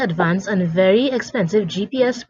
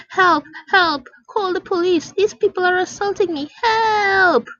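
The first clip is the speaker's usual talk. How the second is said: screaming for help; 10 semitones higher than usual. Acted. Mother screaming for help in the store to get attention. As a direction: scream bloody murder to draw a crowd. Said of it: "starts screaming bloody murder".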